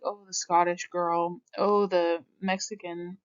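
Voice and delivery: normal talking voice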